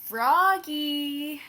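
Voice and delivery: long, loud high call across the yard. Acted. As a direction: call him from far away. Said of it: "called his mother".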